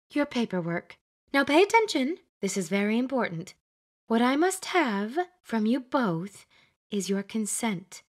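bossy Glenda. Acted. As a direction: lay down the rules like a schoolmistress. Glenda gives instructions.

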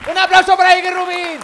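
loud, high stage introduction; hype announcer. Real, not acted. Introducing comedian to a live crowd, shouted hype announcement. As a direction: whip up applause like an MC.

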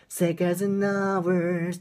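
"seconds and hours" with the D of "and" left out; slow demo, no D in "and"; dropped D demo. Shown as a demonstration. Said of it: "ここのDも言いません".

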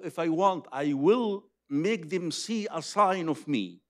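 normal talking voice

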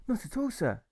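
put-on high, eager boy voice; a boy brushing off thanks, eager; eager boy cry. Alfred protests to the grocer, high eager boy. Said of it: "Alfred cries".

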